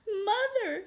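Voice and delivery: high-pitched little kitten; small kitten calling up high to mother. Acted.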